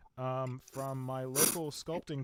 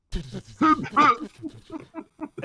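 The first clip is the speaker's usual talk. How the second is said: high cackle; 14 semitones higher, 14 dB louder than usual. Pretend. Mock villain laughing at himself.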